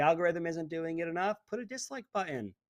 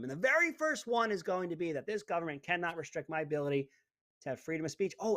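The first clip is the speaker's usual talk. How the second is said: ordinary talk, nothing marked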